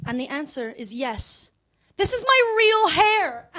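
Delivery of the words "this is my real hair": high, slow exultant announcement; triumphant reveal. Acted. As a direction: proclaim it high and drawn out. Triumphant answer to audience.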